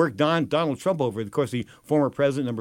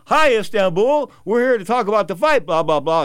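broadcast greeting; 5 semitones higher, 6 dB louder than usual. Retold or imitated. Greeting Istanbul viewers at 2:30 am, hammed-up broadcast voice. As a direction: greet the audience like a TV host.

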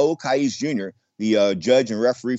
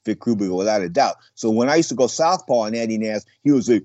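ordinary talk

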